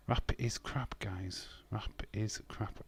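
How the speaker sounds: soft, slow, almost whispered; hushed repeat. Pretend. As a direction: mutter it under your breath. Repeats viewer's line quietly.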